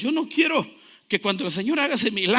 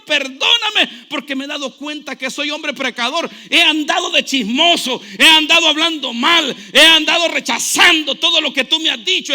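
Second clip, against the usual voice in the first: fearful plea; 6 semitones higher, 10 dB louder than usual. Acted. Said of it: "se llena de temor y dice".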